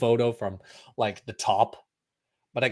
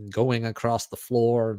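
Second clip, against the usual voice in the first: normal talking voice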